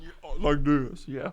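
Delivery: slow, loud, low-pitched voice; exaggerated deep drawl; put-on deep voice. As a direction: drop into a mock deep manly voice. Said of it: "have this deep voice like Kenny".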